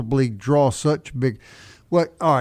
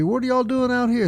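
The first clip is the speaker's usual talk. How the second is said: a lightly voiced curious local, pitched up; friendly Montana local; 12 semitones higher than usual. Friendly Montana local greets Mississippi visitors, lightly voiced curious question. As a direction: ask like a friendly stranger meeting a Southerner, higher.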